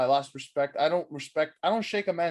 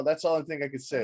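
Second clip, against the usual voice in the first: normal talking voice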